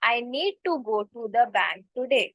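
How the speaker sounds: very slow, clear English demo; slow model sentence. Shown as a demonstration. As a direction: spell it out slowly for learners. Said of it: "तो आप कैसे बोलोगे". (बहुत धीमे, साफ़ अंग्रेज़ी में)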